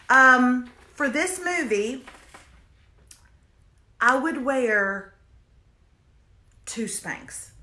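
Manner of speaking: long dramatic pauses, slow. Shown as a demonstration. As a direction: hold the pause before the reveal. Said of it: "I gave a dramatic pause".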